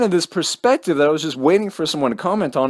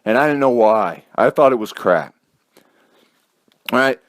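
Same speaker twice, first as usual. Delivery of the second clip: ordinary delivery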